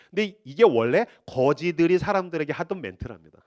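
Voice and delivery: usual speaking voice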